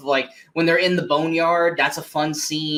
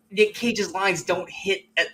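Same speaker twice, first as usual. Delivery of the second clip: ordinary talk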